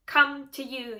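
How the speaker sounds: usual speaking voice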